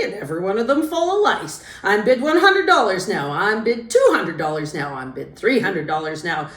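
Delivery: loud sing-song auction chant; auctioneer voice. A narrator's reading. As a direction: call the bids like a country auctioneer. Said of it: "in his newfound sing-song auctioneer voice".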